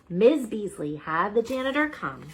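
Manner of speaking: plain reading voice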